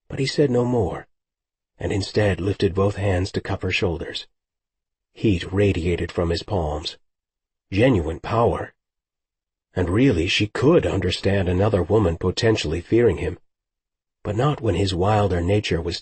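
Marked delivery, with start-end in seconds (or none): none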